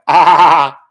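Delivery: loud burst of laughter at his own joke; big laugh. Real, not acted. Host laughing loudly at his own joke. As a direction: let out a real, loud laugh.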